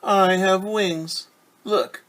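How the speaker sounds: the proud bee flaunts its wings; bumblebee voice. Acted. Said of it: "said Bumblebee".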